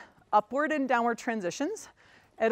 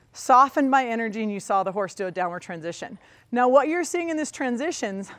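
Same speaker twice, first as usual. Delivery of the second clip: ordinary talk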